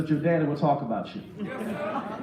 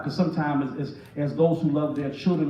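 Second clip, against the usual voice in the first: ordinary delivery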